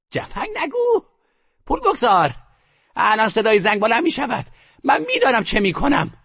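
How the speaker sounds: impatient grandmother. A narrator's reading. Grandmother dismissing the narrator's caution, impatient high voice. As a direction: brush off his warning impatiently.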